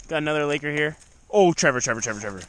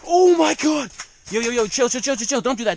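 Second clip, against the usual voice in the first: excited panic; 5 semitones higher, 6 dB louder than usual. Real, not acted. Fish fighting near the hole.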